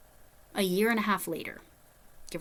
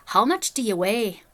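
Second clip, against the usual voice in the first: slow, louder Northern Irish accent attempt; 6 dB louder than usual. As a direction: imitate a Northern Irish man, drawing it out. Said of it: "when I do a Northern Irish accent".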